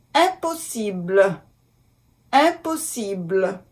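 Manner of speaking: "Impossible" in French, nasal "im-", no m sound; careful French pronunciation. Shown as a demonstration. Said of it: "I M".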